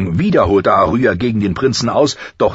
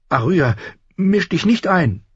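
put-on high, anxious older sister; frightened warning; 11 semitones higher than usual. A narrator's reading. Frightened Sansa warning her sister, anxious. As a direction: warn her off in a raised pitch. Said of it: "Sansa fürchtete sich".

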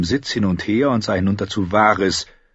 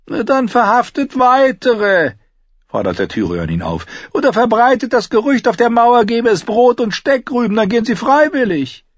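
high, sardonic Tyrion; mocking dwarf voice; 6 dB louder than usual. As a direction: taunt the knight with a sly grin. Tyrion gives orders.

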